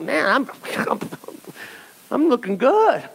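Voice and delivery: self-satisfied, laughing brag; preening gym-goer. Pretend. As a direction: admire yourself in the mirror with a chuckle. Gym-goer admiring himself.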